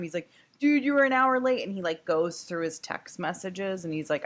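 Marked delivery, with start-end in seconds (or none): 0.6-1.6 higher, louder exasperated snap; exasperated retort. Retold or imitated. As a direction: hit back, fed up with the excuses. Nick voiced in retold argument.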